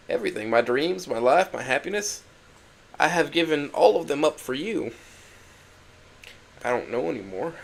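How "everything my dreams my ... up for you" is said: usual speaking voice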